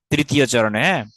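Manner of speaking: usual speaking voice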